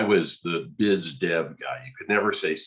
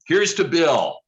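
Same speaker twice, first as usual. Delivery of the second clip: higher and louder, a forced toast; 11 semitones higher, 7 dB louder, slower than usual. Retold or imitated. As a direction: toast loudly through gritted teeth. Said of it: "And I said".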